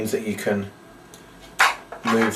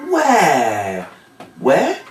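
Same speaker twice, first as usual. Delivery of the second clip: louder, the second where much higher; mock bewildered where; 5 semitones higher, 3 dB louder than usual. Shown as a demonstration. Searching for the sun as the kids yell, loud mock confusion. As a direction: pretend you can't find him. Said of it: "you shout".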